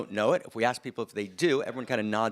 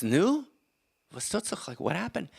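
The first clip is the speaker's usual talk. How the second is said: high, eager Yiddish father; Yiddish accent; 8 semitones higher than usual. Acted. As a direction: Yiddish accent, pressing for news. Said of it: "in a slight Yiddish accent".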